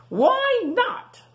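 very high squeaky question; squeaky pig voice. Acted. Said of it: "asked Rufus".

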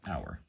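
our said like the English word our; soft low model of our; letter name. Shown as a demonstration. Teacher reading out the IPA for our to students. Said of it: "here's the I P A spelling for that".

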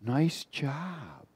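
soft, whispered flattery from the shadow; gentle demon voice. Retold or imitated. Retelling the devil's voice in the van, soft and sly. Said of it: "was real gentle".

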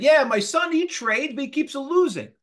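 high-pitched imitation of an Italian father; Italian accent. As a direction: mimic Dad's Italian accent, pitched well up. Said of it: "with this Italian accent".

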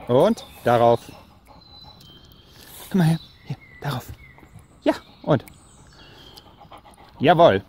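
talking to a dog. Real, not acted. Owner giving dog commands.

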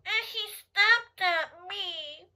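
high, very slow stretched-out words; playful mock distress. Telling children the turtle snapped at her, mock distress. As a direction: draw it out high in mock woe.

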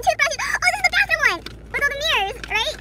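gasping, high and loud delight; thrilled squeal. Real, not acted. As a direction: squeal and gasp at the discovery. Discovers favorite concept version.

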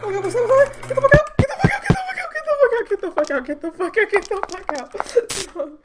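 high, loud, frantic yelling over and over; panicked screaming. Real, not acted. Gamer screaming at a monster chasing her, panicked yells.

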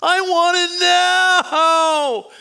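loud, high, drawn-out bratty wail; mock temper tantrum. Fifteen-year-old demanding in the Walmart, hammed-up temper tantrum. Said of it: "throwing a temper tantrum in the Walmart".

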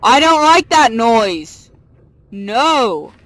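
slow, loud and fed up; loud complaint. Real, not acted. Annoyed by a game noise, slow loud shout. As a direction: belt it out, fed up with the sound.

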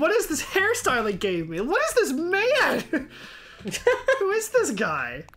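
shocked. Real, not acted. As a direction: react in real shock at the hair. Shocked at hairstyle.

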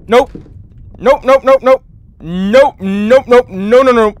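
high, loud panicked nopes; panicked refusal. Real, not acted. Player refusing to enter the room, panicked nopes. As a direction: back away in real fear.

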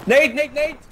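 high, loud urgent call; shouting a name. Real, not acted. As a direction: yell his name to warn him. Calling teammate urgently.

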